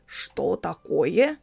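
slow, alarmed exclamation; startled cry. A narrator's reading. Father startled by the dwarf at the door, frightened. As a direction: gasp at the stranger in fright. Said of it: "он испуганно воскликнул".

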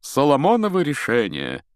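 high, loud, self-important proclamation; lofty grandeur. A narrator's reading. Lockhart congratulates himself as they enter the castle, lofty. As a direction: pronounce it from a great height. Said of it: "с высоты своего величия проговорил".

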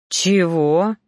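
firm, affronted question; indignant. A narrator's reading. Reaper hears an outrageous demand, indignant. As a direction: balk with mild outrage. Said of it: "возмущённо выпучил глаза Ноан".

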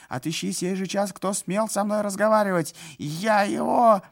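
high, loud threatening order; furious. A narrator's reading. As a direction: threaten through rage. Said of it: "furious official".

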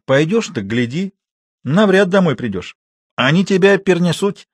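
malicious, impressive threat; menacing warning. A narrator's reading. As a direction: warn with weight, building in pitch. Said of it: "стало злобно и внушительно".

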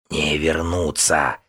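медленное шипение сквозь клюв; прошипи, как дикая птица-зверь; шипящее существо. A narrator's reading. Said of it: "прошипел лишённый ветра". (шипящий голос твари)

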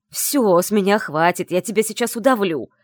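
loud, menacing shout; furious warning. Acted. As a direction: shout the threat, losing control. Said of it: "предупредил Исаев".

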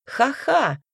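loud, high spoken ha-ha; mock laugh. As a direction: scoff with a sarcastic laugh. Bitter mock laugh at own idea.

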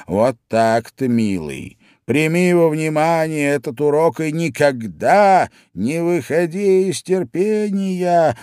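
didactic lecture, climbing high; moralise, rising in pitch as it goes; preachy old crab. A narrator's reading. Old crab lectures young crab, preachily. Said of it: "назидательно сказал юному крабу".

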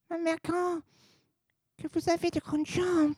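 put-on high, soft, timid child voice; little girl voice. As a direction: ask it small and wondering.

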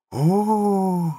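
long high "oh" of wonder; amazed gasp. A narrator's reading. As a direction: let it escape in high-pitched awe. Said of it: "только и вырвалось у неё". (протяжное восхищённое «о»)

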